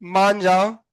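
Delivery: low, drawn-out coaxing; coaxing plea. Pretend. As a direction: plead playfully with a stubborn gadget. Pleading with a balky board.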